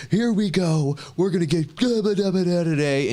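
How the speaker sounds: high, far-off hype voice of a streamer opening; mock the over-the-top YouTuber opener; lightly mocking hype-man voice; hyped streamer intro.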